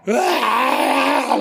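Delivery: long, high mimicked scream; mimicked wail. Retelling the girl going nuts in the film, mimicked wail. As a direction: imitate a drawn-out anguished wail.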